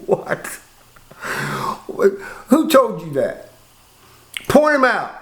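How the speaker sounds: half-laughing "What? who told you that?"; laughing disbelief. Real, not acted. Laughing at the cellie, asking who said it.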